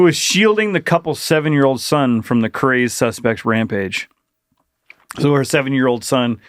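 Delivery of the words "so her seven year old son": ordinary talk, nothing marked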